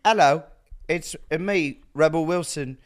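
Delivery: bad Australian accent turned English; Rebel Wilson, English accent. Failed impersonation of the co-star, building to a loud English accent. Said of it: "you're fully doing English".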